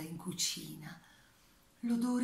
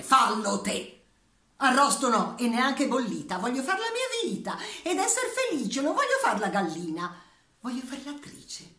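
high, loud rebellious hen voice; defiant hen; 4 semitones higher, 6 dB louder than usual. Acted. Hen telling the farmer she wants to be an actress, high and loud. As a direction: play a hen who refuses the oven. The hen speaks her monologue.

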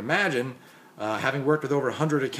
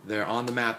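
normal talking voice

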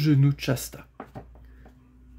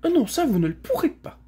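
haughty confirmation; 12 semitones higher than usual. A narrator's reading. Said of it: "confirma la demoiselle".

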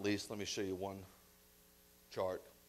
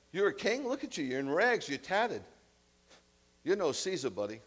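high, louder scoffing laugh at a beggar king; laughing mockery; 6 semitones higher, 5 dB louder than usual. Pilate laughing at Yeshua in rags, high and mocking. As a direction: laugh him off as no Caesar. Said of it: "Pilate said to him".

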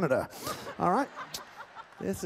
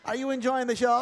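usual speaking voice

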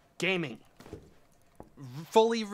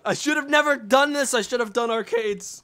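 exasperated lament; 5 semitones higher, 6 dB louder than usual. Real, not acted. Regretting the store after outburst.

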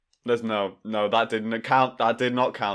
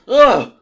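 pained yelp; 11 semitones higher, 12 dB louder than usual. Real, not acted. Reacting to shock.

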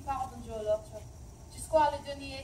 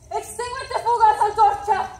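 high, very loud outcry; full shout; 9 semitones higher, 17 dB louder than usual. Acted. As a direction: let it explode at full force. Spanish take repeated, building.

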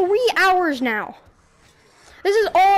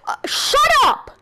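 shut up yell; 6 semitones higher, slower than usual. Real, not acted. Yelling at a friend.

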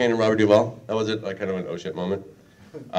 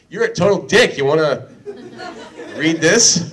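high, loud mock pitch to a friend; bright eager offer, played up; excited retelling; 6 semitones higher, 4 dB louder than usual. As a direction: Gearbox guy jokingly pitching the role. Retold offer from Gearbox guys.

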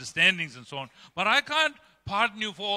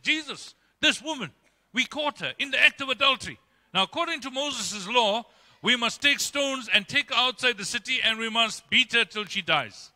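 higher-pitched, insistent accusers; accusing crowd; 6 semitones higher than usual. Retold or imitated. Accusers dragging the woman before Jesus, insistent and higher. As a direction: push the case like eager accusers.

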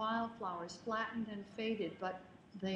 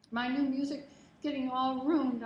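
louder tearful complaint; sobbing; 3 semitones higher, 7 dB louder than usual. Retold or imitated. Girl sobbing to the stranger about her music, louder tearful wail. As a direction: wail it through tears. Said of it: "I sobbed".

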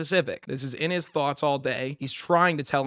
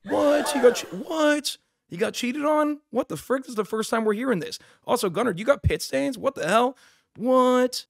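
chat shocked; 9 semitones higher than usual. Retold or imitated. Streamer voices the stunned viewers learning of the cheating. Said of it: "Everyone in chat be like".

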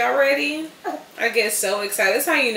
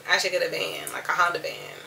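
ordinary delivery

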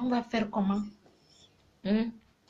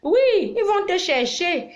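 high, louder mocking yes; mocking retort; 10 semitones higher, 4 dB louder, slower than usual. Answering the guys' mockery, high and louder. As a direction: throw it back at them, pitched up.

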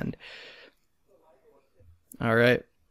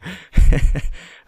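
loud laugh; 4 semitones higher, 11 dB louder than usual. Real, not acted. Laughing at his pain.

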